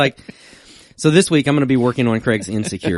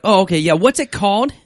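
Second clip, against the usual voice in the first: eager question; 10 semitones higher, 6 dB louder than usual. Retold or imitated. Retold drive-thru order.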